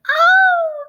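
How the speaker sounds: a shrill surprised squawk; startled squawk. A narrator's reading. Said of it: "Chick said".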